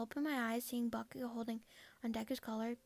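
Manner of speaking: usual speaking voice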